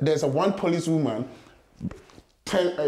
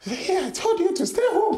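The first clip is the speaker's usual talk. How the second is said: imitating mother; 12 semitones higher than usual. Mother's voice, laughing.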